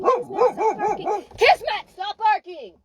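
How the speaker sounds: rising dog commands; escalating commands. Shown as a demonstration. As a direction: raise the voice with each try. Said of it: "See the difference?".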